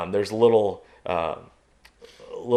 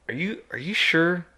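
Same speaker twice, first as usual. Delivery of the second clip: higher incredulous question; doubtful asking; 8 semitones higher than usual. Retold or imitated. Doubting the woman who saw a bear, higher incredulous ask. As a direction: doubt her sighting. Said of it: "And I is like".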